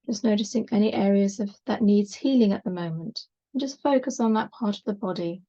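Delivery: unhurried yoga relaxation voice with long pauses; measured, calming body-scan delivery; guided meditation. Acted. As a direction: gently guide them into rest, leaving space between phrases.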